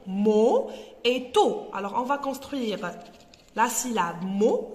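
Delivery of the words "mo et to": mo and to said slowly and loud; slow teacher syllabifying; slow syllables. Shown as a demonstration. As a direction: separate the two syllables slowly. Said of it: "deux syllabes".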